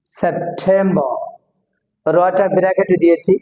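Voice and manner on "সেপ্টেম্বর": সেপ্টেম্বর slowly as "sep-them-ba"; aspirated T, silent R. Shown as a demonstration. Teacher drilling the T rule, সেপ্টেম্বর with aspirated T. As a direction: puff the T of সেপ্টেম্বর, drop the R. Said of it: "টিটা উচ্চারণ হবে টি".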